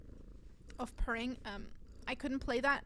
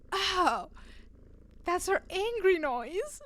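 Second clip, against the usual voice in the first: high, loud mock revelation; a drawn-out "oh" of sudden understanding; dawning realisation; 6 semitones higher, 7 dB louder, slower than usual. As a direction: act out the penny dropping. Acting the family's sudden realisation.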